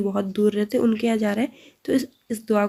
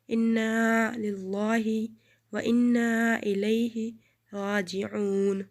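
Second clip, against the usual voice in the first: very slow, measured Quranic recitation; 5 dB quieter, much slower than usual. Shown as a demonstration. Teacher reciting the verse slowly to the class. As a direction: draw out each word of the verse for the students. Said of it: "ایک بار پھر سے".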